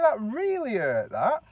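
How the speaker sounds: slow, high, loud put-on grievance; mock hurt complaint. Winding up the husband, slow mock complaint. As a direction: overplay the pain, drawn out. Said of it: "You know, joking like".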